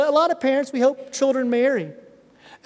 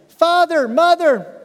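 father, mother said higher and louder, each word punched; emphatic words; 7 semitones higher, 6 dB louder, slower than usual. Acted. Preacher stressing Paul's family words to the church, emphatic.

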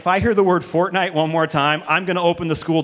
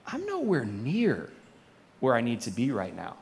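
softer, dawning dismay; hushed realization; 7 semitones lower, 9 dB quieter than usual. Retold or imitated. Person realizing they are off track, soft hushed realization. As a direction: let it sink in quietly. Inner realization of being lost.

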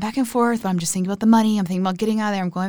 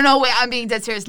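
normal talking voice

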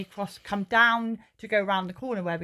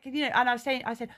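usual speaking voice

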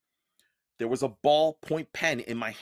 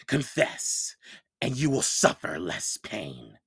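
menacing teacher; 5 semitones lower, slower than usual. Acted. Monster teacher threatens Percy.

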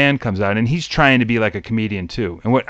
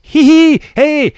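very high, loud corny joke-teller; hammed-up, pitched-way-up gag delivery; Unknown Comic; 10 semitones higher, 5 dB louder than usual. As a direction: imitate a goofy stage comic landing a groaner. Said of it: "was the unknown comic".

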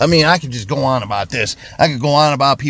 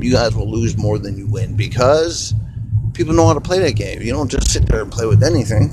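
ordinary talk

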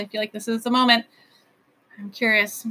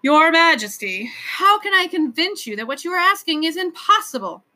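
exasperated; 7 semitones higher, 4 dB louder than usual. A narrator's reading. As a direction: plead in exasperation with someone who won't listen. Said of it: "gave an exasperated sigh".

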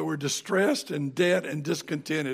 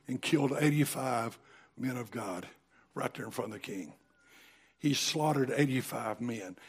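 much softer and lower, heavy with sorrow; hushed, grave telling; 3 semitones lower, 6 dB quieter than usual. Real, not acted. Preacher hushes his congregation over the murdered priests. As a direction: drop the voice for the slaughter.

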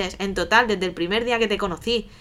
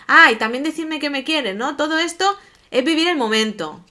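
angry sarcasm; 6 semitones higher than usual. Retold or imitated. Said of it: "alzo el tono y es que me cabreé".

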